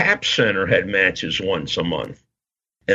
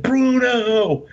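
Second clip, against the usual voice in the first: excited call; 12 semitones higher than usual. Retold or imitated. Fan runs up to Bruno at elevator, excited call. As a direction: shout his name like a starstruck kid.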